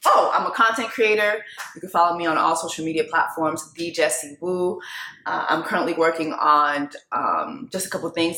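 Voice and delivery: usual speaking voice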